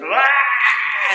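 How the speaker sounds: high, loud panicked shout; startled scream. Retold or imitated. Patient waking under figures, screaming in panic. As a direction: cry out in sudden terror.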